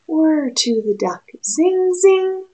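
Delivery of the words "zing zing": high, bright zing sound; zinging buzz. A narrator's reading. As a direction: zip it out like darting dragonflies.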